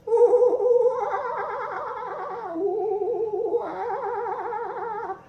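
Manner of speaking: high hooting ooh-ah bird cries; a grandpa's high bird whoops for the little ones; kookaburra call. Retold or imitated. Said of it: "That's what kookaburras do".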